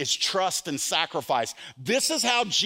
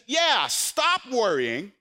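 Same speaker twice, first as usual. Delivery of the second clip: very slow, higher, deliberate; slow firm command; 5 semitones higher, much slower than usual. Acted. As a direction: draw out the order slowly. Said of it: "He says".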